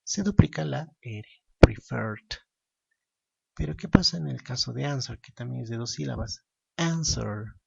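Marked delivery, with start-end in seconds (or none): 1.6-2.3 "preferred" loud, stressed on its second syllable; stress on last syllable. Shown as a demonstration. Teacher showing the doubled R rule, "preferred" stressed on the end. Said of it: "la mayor fuerza de voz recae en la última". 6.8-7.5 "Answer" with the weight on AN-; stress on first syllable. Shown as a demonstration. Teacher contrasting two-syllable verbs, "Answer" stressed at the start. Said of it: "La mayor fuerza de voz recae en la primera sílaba".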